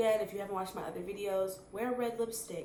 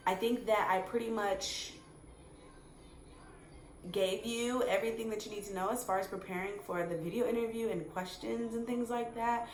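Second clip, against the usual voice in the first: ordinary delivery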